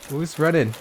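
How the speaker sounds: normal talking voice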